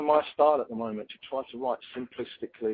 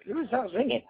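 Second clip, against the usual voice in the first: high, startled disbelief; amazed at his own voice; surprised; 9 semitones higher than usual. Retold or imitated. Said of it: "I thought".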